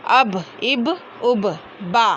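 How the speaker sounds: अब, इब, उब, बा closed on the wet inner lips; each b in अब, इब, उब released crisply from both lips; letter ba demonstrated. Shown as a demonstration. Teacher showing students the articulation of ba, careful demonstration. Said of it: "दोनों होंठों के गीले हिस्से से अदा होता है".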